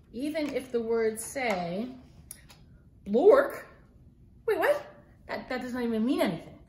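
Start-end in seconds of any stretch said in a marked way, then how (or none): 3.1-3.6 loud silly word. Acted. Reading forced silly word. 4.5-4.9 high, startled wait what; mock surprise. Reacting to nonsense word.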